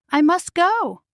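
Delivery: a mild urgent cry; lightly voiced panic. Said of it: "cried Cinderella".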